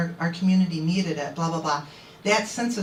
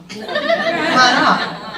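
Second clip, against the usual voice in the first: higher, louder, slow and laughing taunt; mock sweet invitation; 9 semitones higher, 8 dB louder, much slower than usual. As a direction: sing it out like a teasing dare.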